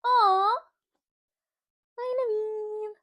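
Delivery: high, drawn-out adoring coo; cooing greeting. Real, not acted. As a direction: coo hello to a little darling.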